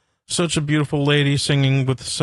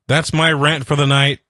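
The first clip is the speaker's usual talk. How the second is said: ordinary talk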